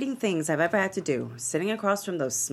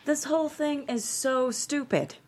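exasperated outburst; 7 semitones higher than usual. Acted. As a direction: vent at the absurdity of it all. Oprah angry about the lawsuit.

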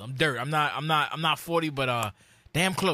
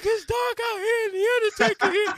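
put-on much higher, whiny crying child voice; scared crying kid; 3 dB louder than usual. Little boy to his dad in the dark arena, high crying whine. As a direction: imitate your younger self sobbing in fear. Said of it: "I started crying".

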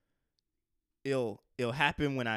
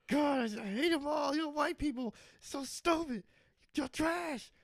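mock angry shout. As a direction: shout it like a fake tantrum at the crowd. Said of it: "I get a little racist when people don't laugh".

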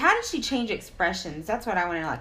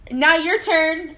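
playful handover; 7 semitones higher, 7 dB louder, much slower than usual. Real, not acted. Handing over, laughing.

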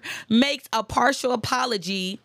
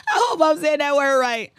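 laughing through the words, higher and louder; 6 semitones higher, 6 dB louder than usual. Real, not acted. Host laughing at her own stumble on a word. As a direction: giggle it out at yourself.

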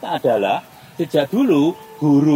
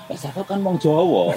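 put-on slower, lightly Javanese-accented aside; Javanese slip; slower than usual. Interpreter lapsing mid-job, light Javanese accent. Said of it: "kadang bahasa Jawa saya keluar".

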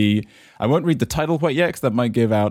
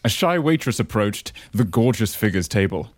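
ordinary talk, nothing marked

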